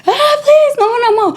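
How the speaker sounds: very high, imitated scream of begging; terrified pleading. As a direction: shriek the clerk's plea. Store clerk begging during robbery.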